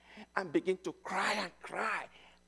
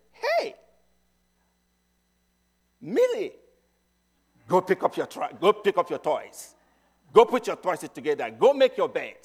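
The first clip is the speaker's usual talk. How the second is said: put-on louder, barking orders at a messy kid; parent shouting orders; 8 dB louder than usual. Mom or dad shouting at Millie to clean her room, loud and commanding.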